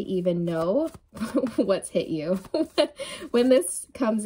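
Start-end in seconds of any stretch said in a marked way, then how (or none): 1.0-1.5 light, brief chuckle. Real, not acted. Laughing about what's coming. 2.5-3.2 light, brief chuckle. Real, not acted. Laughing about what's coming.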